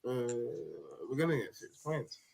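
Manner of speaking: a soft, defeated mock quote; deflated mock Tony. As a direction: mimic him losing his voice, trailing and soft. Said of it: "Tony lost volume in his voice".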